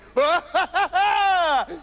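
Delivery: booming gleeful laughter; a big triumphant guffaw; loud laugh. A narrator's reading. Trousers laughs loudly behind the stove. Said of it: "began to laugh loudly".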